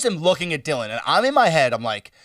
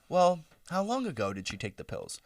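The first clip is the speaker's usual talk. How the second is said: much softer, unhurried; imitating a stoned mom's calm; calm questions; 12 dB quieter than usual. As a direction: ask like nothing is wrong. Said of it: "very calm questions".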